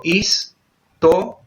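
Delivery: "historia" broken as his, to, ria with pauses; "historia" in three spaced-out beats; split into syllables. Shown as a demonstration. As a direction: separate the syllables of "historia" slowly. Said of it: "separar las sílabas en español".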